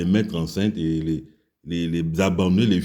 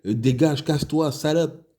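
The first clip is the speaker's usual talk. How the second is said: imitation aiguë et méprisante des militaires; insulte imitée; 7 semitones higher than usual. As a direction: singe le soldat qui l'insulte avec mépris. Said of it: "en train de l'insulter". (voix plus aiguë, méprisante, de soldat qui chasse la fille)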